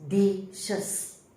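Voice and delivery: DISHES slowly split into di-shes; two-syllable pronunciation. Shown as a demonstration. Teacher showing a plural's syllables, two-syllable pronunciation of DISHES, di-shes. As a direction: say "dishes" in two separate beats. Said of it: "your pronunciation becomes".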